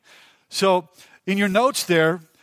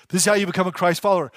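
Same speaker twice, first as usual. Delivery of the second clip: "This is how you become a Christ follower" rattled off quickly, weight on "how"; fast restatement; much faster than usual. Shown as a demonstration. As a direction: repeat it briskly to drive it home. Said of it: "take note of this verse".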